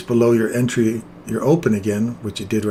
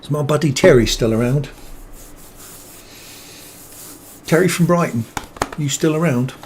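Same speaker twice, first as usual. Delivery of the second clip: higher, louder call to a friend in chat; hailing a buddy, higher and louder; 4 semitones higher, 4 dB louder than usual. Real, not acted. Streamer calls out to his buddy Terry in chat, higher and louder. Calling out to a viewer.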